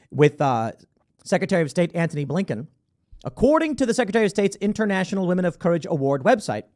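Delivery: ordinary talk, nothing marked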